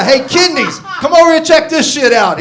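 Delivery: high, loud taunting yell; yelling ass. Retold or imitated. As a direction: bellow a dare at yourself like a bully. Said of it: "my ass starts yelling at me".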